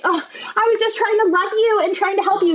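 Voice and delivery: high, pleading mock-tearful mom; crying mom. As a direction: whine it like a hurt mother. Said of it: "started crying and saying".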